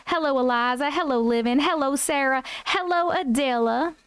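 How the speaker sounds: a hint of Southern accent. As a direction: play a friendly Southern host. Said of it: "We are Southern right now".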